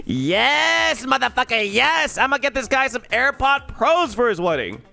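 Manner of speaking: loud, high-pitched triumphant yelling; jubilant, hyped-up cheer; elated celebration. Retold or imitated. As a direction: shout it like you just struck gold. Excited reaction on finding a gift idea.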